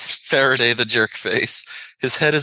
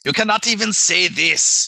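higher, mock Centauri accent; 5 semitones higher than usual. Podcaster teasing a co-host who can't say much, Centauri accent. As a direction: tease in a put-on Centauri accent. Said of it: "podcasting in this voice tonight".

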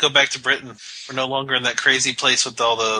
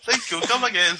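mock Apu; 5 semitones higher than usual. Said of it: "I'm trying to do his Apu".

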